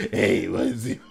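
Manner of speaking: put-on leering dirty old man voice; creepy old lecher, laughing through it. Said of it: "You sounded like a dirty old man".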